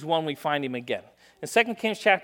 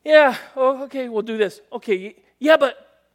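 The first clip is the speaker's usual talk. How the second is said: put-on higher, louder reluctant kid excuses; mock yeah-buts; 7 semitones higher, 5 dB louder than usual. Son making excuses to mom and dad, mock reluctant. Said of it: "I would come up with the yeah buts".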